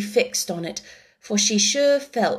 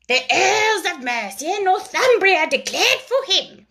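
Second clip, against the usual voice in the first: high, self-important lecturing; resume the lecture grandly; pompous mouse; 9 semitones higher than usual. A narrator's reading. The mouse resumes its history lesson, high and pompous.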